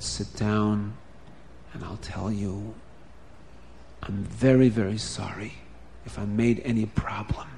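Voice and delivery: hushed confession. Acted. The guilty sheikhet to Bubby, low and hushed.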